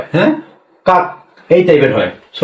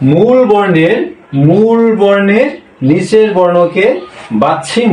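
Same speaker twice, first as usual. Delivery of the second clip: slow recap; 3 semitones higher, slower than usual. Shown as a demonstration. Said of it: "আমরা কি শিখলাম?".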